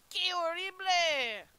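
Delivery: slow, badly faked Spanish accent. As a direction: exclaim it in a hammed-up Spanish accent. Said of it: "That was not a great Spanish accent".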